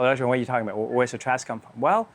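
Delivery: usual speaking voice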